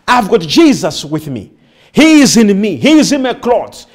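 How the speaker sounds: ordinary talk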